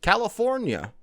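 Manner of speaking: high-pitched mock Bulgarian accent. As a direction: say it in a put-on, swooping Bulgarian accent. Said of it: "in her fine-ass Bulgarian accent".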